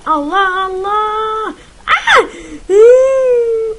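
very high, very loud scream of fear; terrified shriek. Real, not acted. Gamer shrieking as he is about to die. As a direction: shriek as the hit lands. Being shot, near death.

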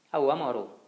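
angry shout. Acted. Riccetto shouting at the rower to stop, angry yell. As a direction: call him out sharply across the boat. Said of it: "gli gridò il Riccetto".

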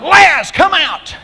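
high, slow, full-force holler; long-drawn high shout; hollered summons. Retold or imitated. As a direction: holler it to raise the dead. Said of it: "He's hollered".

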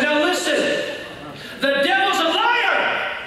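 high, slow, drawn-out preacher's shout; forceful rebuke. Acted. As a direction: thunder it at a deceived man to break the lie. Evangelist confronts deceived, screaming man.

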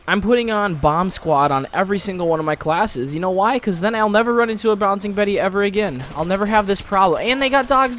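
loud, exasperated gamer rant; frustrated rant. Real, not acted. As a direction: rant loudly in exasperation at the game. Ranting after another death in game.

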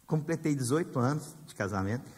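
normal talking voice

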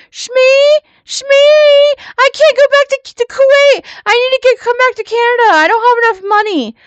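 high, loud panicked phone plea, hammed up; mock panic. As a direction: imitate someone wailing in desperate, exaggerated panic. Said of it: "called her in a panic".

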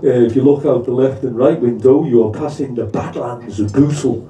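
low, droning tannoy voice; imitating a guard's deadpan commentary over the speaker; mock train announcement, pitched well down. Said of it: "on the voice, Sonny goes".

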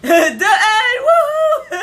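hammed-up announcer whoop; imitating the announcer. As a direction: belt it out like a game-show announcer. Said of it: "That's what the announcer said".

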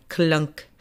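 ordinary delivery

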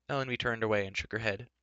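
usual speaking voice

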